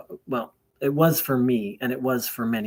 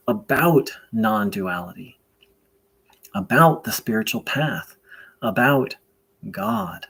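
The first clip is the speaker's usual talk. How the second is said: prayer invocation; 4 dB louder than usual. Real, not acted. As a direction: pray aloud deliberately, leaving long pauses between phrases.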